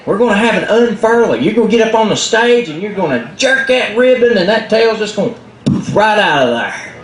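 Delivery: high, coaxing hurried voice; eager Cricket. Acted. Cricket coaxing Possum about the unfurling, high and eager. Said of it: "Cricket said".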